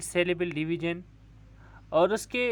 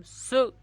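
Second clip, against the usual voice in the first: स alone as a high "su", then stop; isolated syllable; 6 semitones higher, 3 dB louder than usual. Shown as a demonstration. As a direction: break off sharply after स. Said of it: "यहाँ पे हमारी आवाज़ टूटी". (स पर आवाज़ तोड़कर, ऊँचे सुर में)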